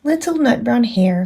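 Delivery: usual speaking voice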